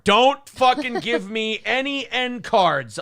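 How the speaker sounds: high, slow, emphatic protest; emphatic refusal. Real, not acted. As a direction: refuse it, stretching out every word. Emphatic refusal to an offered gift.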